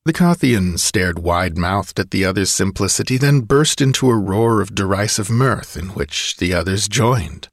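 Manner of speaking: plain reading voice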